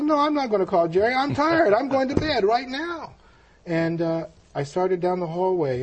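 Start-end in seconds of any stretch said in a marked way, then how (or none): 0.0-3.1 high, louder acted protest; hammed-up tired tantrum; defiant refusal. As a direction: push back stubbornly, pitch jumping up.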